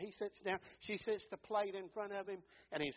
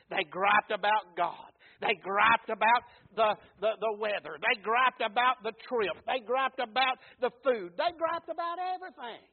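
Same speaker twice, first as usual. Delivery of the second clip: louder, rising list, each griped punched; preaching at full force; 10 dB louder than usual. Acted. Pastor listing the Israelites' complaints to the church, full-force preaching. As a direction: build the list like a hammer.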